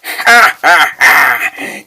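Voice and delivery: slow drawn-out evil laugh; villain laugh. Acted. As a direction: laugh slowly, savouring the win. Villain mocks the old hero.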